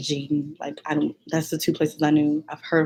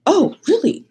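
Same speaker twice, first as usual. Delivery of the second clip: teacher surprised; 12 semitones higher, 4 dB louder than usual. Retold or imitated. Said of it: "she was like".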